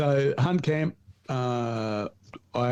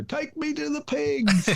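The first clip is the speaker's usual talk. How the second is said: high, slow, stretched-out yell; yelled demand; slower than usual. Retold or imitated. Yelling at the guide every morning, high and slow. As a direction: call it out like a morning battle cry. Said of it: "And just yell it out".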